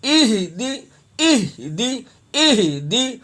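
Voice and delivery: اہدی broken as "ih... dee"; syllable-split ihdi. Shown as a demonstration. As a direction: separate breathy "ih" from a clear "dee". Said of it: "دی پڑھنا ہے". (اہدی، "دی" صاف ادا کریں)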